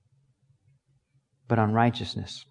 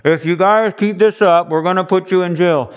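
police warning; 3 semitones higher, 5 dB louder than usual. Acted. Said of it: "the police say".

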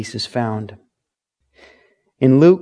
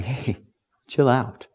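calm reassurance; 7 dB quieter than usual. Retold or imitated. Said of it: "Jesus is like".

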